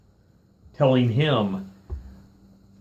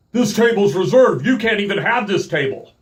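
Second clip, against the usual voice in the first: higher, louder firm official voice; stern worker; 10 semitones higher, 5 dB louder than usual. Retold or imitated. Park worker telling the family off, higher firm insistence. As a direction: lay down the rule like a petty official.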